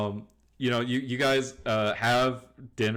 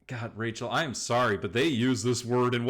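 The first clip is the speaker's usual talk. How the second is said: ordinary talk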